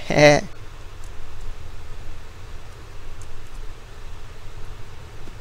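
soft unvoiced laugh at himself; sheepish little laugh, caught cheating; quiet chuckle. Real, not acted. Player laughing softly after cheating, quiet chuckle.

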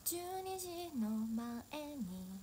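a soft, dreamy Cinderella verse ending in a whisper; dramatic recitation. Pretend. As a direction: recite it like a wistful stage monologue. Dramatic poetic Cinderella monologue.

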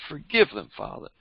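ordinary delivery

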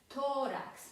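Tórax with a prominent first syllable; penultimate stress. Shown as a demonstration. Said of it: "akcent pada na przedostatnią sylabę".

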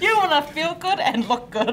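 said through a laugh; chuckling along with the host. Real, not acted. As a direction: laugh while agreeing, bright and lifted. Laughing agreement on show.

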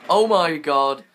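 loud shocked gasp; startled shout. Real, not acted. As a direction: blurt it out in shock. Sudden jaguar attack.

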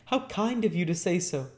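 put-on high, earnest boy's voice; grateful cry as Oliver. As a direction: thank her as an overjoyed child. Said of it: "cried Oliver".

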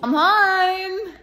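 high, loud call through the house. Real, not acted. Back from the shops, calling out to her partner. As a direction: sing out the arrival to be heard in another room.

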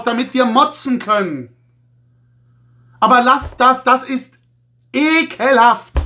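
hammed-up scolding of the company; mock pleading rant. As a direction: fake disgust, pleading for worse sets. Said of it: "ironische, sarkastische Nummer".

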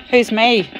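loud, high calling out. Real, not acted. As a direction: shout the question into the dark. Calling out to the spirit.

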